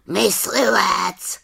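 high, slow croaking raven voice. Acted. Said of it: "zakrákal po chvíli Havran". (krákavý havraní hlas)

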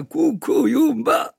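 high, slow exotic chant of a native friend; Kvíkvék's strange words. Acted. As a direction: sing out his odd greeting slowly. Kvíkvék speaks his language.

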